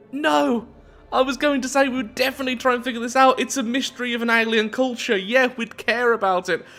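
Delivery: high-pitched rapid protest; indignant protest. Real, not acted. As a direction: protest in a high, hurried rush. Protesting costly in-game choice.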